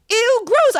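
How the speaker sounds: mother reacting to the photo; mom's ew gross. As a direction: squeal it like a grossed-out mom. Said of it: "that was her whole comment".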